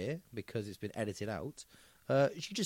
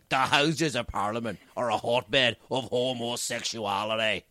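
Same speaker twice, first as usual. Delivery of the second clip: slow, booming Ulster preacher rant; drunken mimicry of a thundering Ulsterman; drunk Ian Paisley; 3 semitones lower, 4 dB louder than usual. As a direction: hammer it out slowly in a thick Ulster accent. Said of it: "when he was drunk, go".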